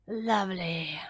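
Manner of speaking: whispered low snort; a rough snort that slips out; wolf snort. A narrator's reading. Wolf forgetting his disguise, whispered snort. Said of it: "snort the wolf".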